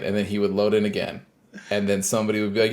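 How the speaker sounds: normal talking voice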